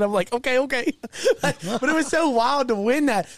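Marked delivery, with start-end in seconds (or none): 0.3-0.9 lightly voiced little kid, higher; a hint of an eager little kid. Teller picking up bingo winnings, lightly mimicking a little kid. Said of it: "like I'm a little kid".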